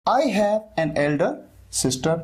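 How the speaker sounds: each word drawn out for learners; very slow model sentence. Shown as a demonstration. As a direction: dictate it slowly. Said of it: "modelling sentence slowly". (बहुत धीरे, सिखाते हुए)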